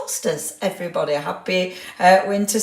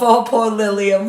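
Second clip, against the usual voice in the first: usual speaking voice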